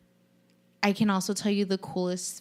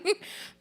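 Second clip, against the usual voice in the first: laugh; 13 semitones higher than usual. Real, not acted. Laughs after joke.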